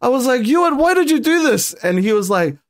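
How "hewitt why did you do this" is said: pitched way up, half-laughing disbelief; incredulous outcry. Retold or imitated. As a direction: call out in mock shock at the teammate. Retelling shouting at teammate.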